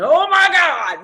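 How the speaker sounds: loud, slow shout of awe; awed shout. Real, not acted. Coach exclaiming at the presidential diamond rank, awed shout. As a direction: stretch it out in loud amazement.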